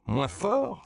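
very high, incredulous echo; exclamation outrée. A narrator's reading. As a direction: throw it back as a shocked question. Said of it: "s'écria Vargas". (s'écrier, incrédule)

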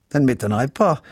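usual speaking voice

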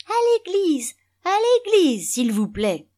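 very high mocking falsetto; mimicking his son. A narrator's reading. Father aping his son's answer half to himself, high mocking voice. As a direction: ape a child's voice in derision, shrinking back on the last words. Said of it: "Il commença à singer l'accent plat de son fils".

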